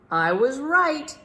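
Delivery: loud, high and slow triumph; triumphant. Acted. Big Max turning to find the crocodile, triumphant. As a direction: proclaim it like a win.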